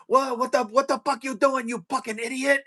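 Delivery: high-pitched mock yell, no real volume; screaming Filipino instructor. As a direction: imitate a Filipino drill instructor in your face. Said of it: "he's screaming".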